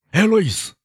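urgent, high call of a name. A narrator's reading. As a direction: call out to her through the house. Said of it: "他叫道".

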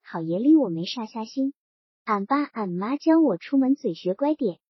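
usual speaking voice

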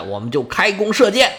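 ordinary delivery

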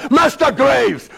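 high, drawn-out headmaster's bellow; stern, stretched-out schoolmaster shout; impatient shout. Acted. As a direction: call the pupil out loudly and impatiently. Headmaster impatiently summons pupil to read.

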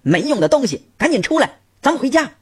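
shrieking order to leave; angry yell. A narrator's reading. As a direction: snap at a useless husband. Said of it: "立刻朝屋里的老公吼道".

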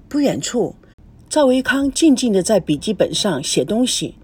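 ordinary delivery

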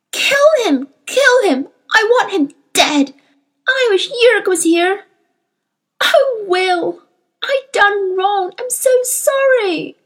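put-on high, loud, anguished child's outburst; sobbing with rage. A narrator's reading. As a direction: cry it out through furious sobs, dragging the last words. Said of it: "sobbing with rage".

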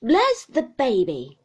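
slow, loud exclamation; stern aunt blurts out, slow and loud; exclaiming. Acted. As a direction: exclaim it with sudden feeling. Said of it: "exclaimed Miss Betty".

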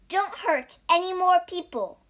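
high, loud admonishment; stern warning. Acted. Monk warns the monkey.

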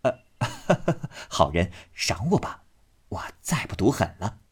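coaxing, laughing entreaty; ingratiating plea. A narrator's reading. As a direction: chuckle obsequiously while begging. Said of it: "忙陪笑央求道".

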